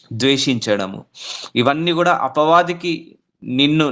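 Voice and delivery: normal talking voice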